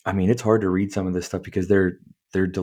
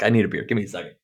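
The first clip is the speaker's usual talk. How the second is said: ordinary talk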